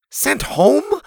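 high, rising shocked question. A narrator's reading. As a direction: gasp it out in disbelief. Boy shocked by threat.

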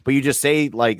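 usual speaking voice

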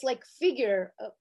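usual speaking voice

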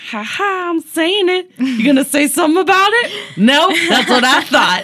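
high laughing taunt; cocky taunting. Retold or imitated. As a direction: dare them to say something. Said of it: "You're like".

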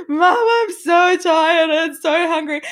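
put-on high, slow, tired child voice; a worn-out kid whining to mom; mother imitating her crying sons. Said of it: "the boys are both crying in my arms".

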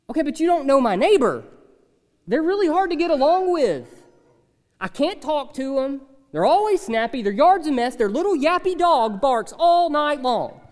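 lightly voiced grumbling worker; complainer. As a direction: plead your case to the pastor. Imagined complaining listener.

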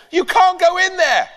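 high, loud desperate yell. Acted. Ben yelling to stop the neighbour entering, high and loud. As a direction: yell to block him. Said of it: "yelled Ben".

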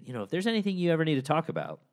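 high, quick friendly voice; imitating a kind offer. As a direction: mimic a sweet, eager helper. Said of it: "and she said".

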